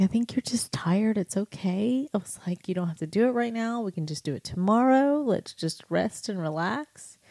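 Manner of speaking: high, soft reassurance; calm a tired child gently; soothing mother. Retold or imitated. Mother reassures her tired son, high and soft.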